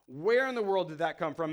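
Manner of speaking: ordinary talk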